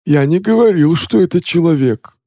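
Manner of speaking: captive's voice. Acted. Captive speaks in role voice.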